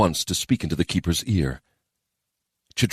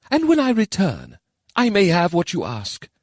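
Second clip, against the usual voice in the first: keeper voice; 12 semitones higher than usual. Acted. Keeper answers.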